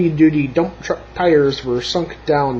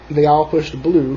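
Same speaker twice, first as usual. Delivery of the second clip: ordinary delivery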